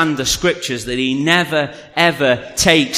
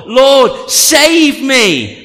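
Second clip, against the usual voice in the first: high, louder cry for rescue; 9 semitones higher, 6 dB louder, much slower than usual. Retold or imitated. Peter sinking calls to Jesus, crying out. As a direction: call out urgently to be saved. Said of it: "He cries out to the Lord".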